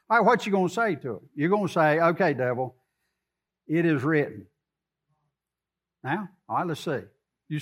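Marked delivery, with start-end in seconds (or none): 2.0-4.5 calm answer to the devil. Acted.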